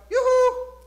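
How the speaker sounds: high, loud sing-song yoo-hoo; calling yoo-hoo. Pretend. God calling to people who lost him, high sing-song. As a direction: call out playfully from afar.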